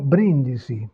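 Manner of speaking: "brindisi" with a short stressed BRIN; teacher's model of "brindisi", stressed vowel kept short; no vowel lengthening. Shown as a demonstration. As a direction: close the first syllable of "brindisi" on the N. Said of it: "donc pas d'allongement vocalique".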